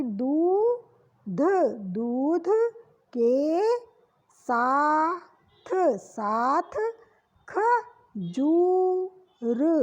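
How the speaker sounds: drawn-out syllables, teacher style; sounding out words. Shown as a demonstration. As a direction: stretch each word slowly for the class. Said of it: "दा पे बड़ी ऊ की मात्रा लगी".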